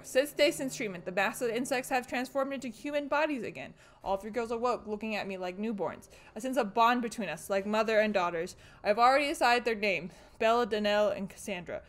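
diary reading. Acted. Streamer reading the doctor's diary aloud, quiet reading. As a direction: read the notes low and eerie.

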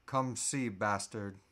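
usual speaking voice

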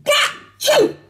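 a big high acted sneeze; loud sneeze. Narrator sneezing a third time mid-argument, big sneeze. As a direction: another hammed-up sneeze. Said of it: "And then I sneezed again".

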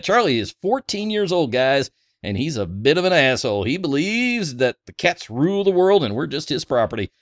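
ordinary delivery

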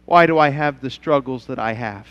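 quiet, low questioning voice; a hurting member asking why; doubting churchgoer. Retold or imitated. Churchgoer asking the pastor why, quiet and low. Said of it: "You say".

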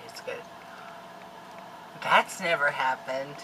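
normal talking voice

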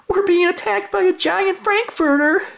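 very high frantic cat voice; panicked cats. Acted. Cats running from Oscar, high frantic shriek. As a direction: shriek it while running away. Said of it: "cried the cats".

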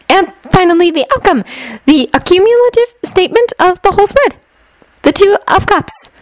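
put-on very high-pitched, girlish reading; eager young fortune-teller. Shinobu reads Haruhi her tarot spread, high and eager. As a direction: present the cards in a high, sweet little voice.

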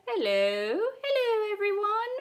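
high-pitched cartoon piglet voice; hammed-up squeaky little character greeting; terrible Peppa Pig. Greeting viewers as a cartoon pig, bad high-pitched impression. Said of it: "My Peppa Pig accent is terrible".

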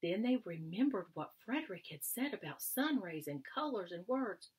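gentle read-aloud storytelling voice; story narration. A narrator's reading. Teacher reading a story to young children, storytelling tone. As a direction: read it like a picture book to kids.

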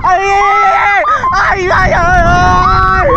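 high loud repeated screams. Real, not acted. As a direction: yelp with each drop. Screaming on a thrill ride.

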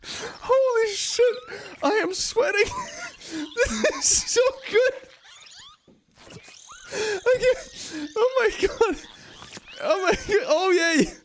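high, squeaky, tearful laughing speech; helpless real laughter. Real, not acted. Player watching creatures mate, helpless laughter. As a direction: squeeze words out while cracking up. Cracking up at mating scene.

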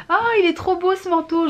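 high, delighted gasp at a coat; mock gushing over a coat; gushing shopper.

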